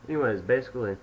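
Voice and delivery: usual speaking voice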